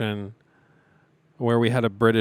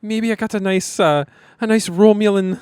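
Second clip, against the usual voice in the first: lightly voiced Irish brogue, higher; mock Irish accent; 9 semitones higher, 6 dB louder than usual. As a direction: play along in stage Irish. Joining the Irish bit.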